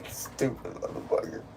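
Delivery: ordinary talk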